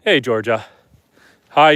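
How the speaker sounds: ordinary talk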